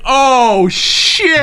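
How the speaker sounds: startled shout, rising high. Retold or imitated. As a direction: gasp it out as if scared. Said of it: "we're all like".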